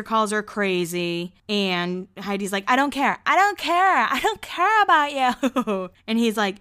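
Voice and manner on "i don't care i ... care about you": rising from firm to high shout; angry yelling. Retold or imitated. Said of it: "they're both kinda yelling".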